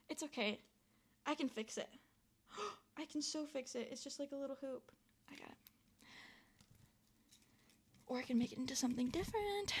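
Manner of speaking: very soft, almost whispered reassurance; calm yourself down under your breath; hushed self-soothing. Real, not acted. Reassuring herself about a setback, barely audible.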